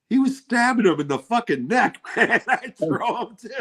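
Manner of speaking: high-pitched incredulous protest; mock outrage. Husband arguing back over the movie kid thrown off a bridge, incredulous.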